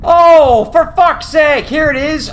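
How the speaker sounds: loud, high cry of frustration; exasperated outburst. Real, not acted. Gamer finally spotting the clue, exasperated cry. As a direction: burst out, loud at first then easing.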